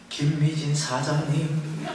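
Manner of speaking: low, very slow, eerie voice; ghostly call. Retold or imitated. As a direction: draw the name out like a ghost in a dark hall. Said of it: "귀신 같잖아요".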